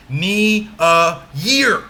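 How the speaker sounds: slow, separated syllables landing hard on "year"; "Ni, a" lighter, then a big, drawn-out "year"; overdone stress on year. Shown as a demonstration. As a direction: exaggerate "year" so the class can hear it. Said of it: "I'm overdoing it a bit just so you guys can hear it".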